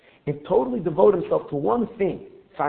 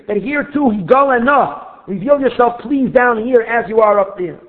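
higher and louder, impassioned sermon voice; a rabbi stirring his listeners with heat; fervent preaching; 4 semitones higher, 8 dB louder than usual. Acted. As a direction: preach it with rising fervor.

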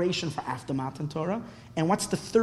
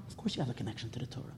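much softer, fast throwaway; quick quiet aside; 7 semitones lower, 16 dB quieter, much faster than usual. Real, not acted. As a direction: toss it off under your breath. Quick answer to own question.

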